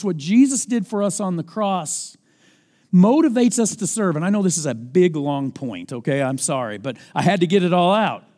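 normal talking voice

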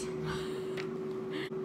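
breathy laugh. Real, not acted. After self-deprecating remark.

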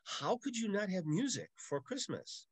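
a seventh grader pleading with the priest; upset kid. Retold or imitated. Seventh grader asking the priest, mock pleading. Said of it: "And I said".

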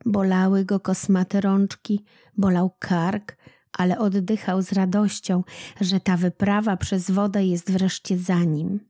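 usual speaking voice

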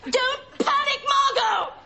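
high urgent shout. Acted. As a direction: yell it down the phone. Calling urgently down the phone.

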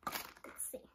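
whispered, soft to herself; whispering. Real, not acted. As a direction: whisper it under your breath. Quiet to herself.

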